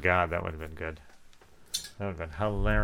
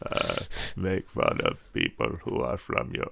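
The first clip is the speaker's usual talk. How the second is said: lightly voiced whining oddball; mock character voice; 9 semitones lower than usual.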